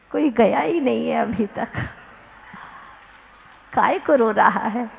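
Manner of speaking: ordinary delivery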